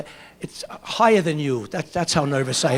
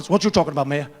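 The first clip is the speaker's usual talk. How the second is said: Gary Coleman impression; faster than usual. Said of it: "Gary Coleman".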